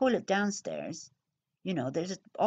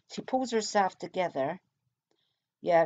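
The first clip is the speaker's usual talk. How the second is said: normal talking voice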